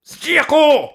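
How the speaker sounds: high, drawn-out call; roaring call. Acted. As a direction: roar the name across the field. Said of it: "No i ryczą".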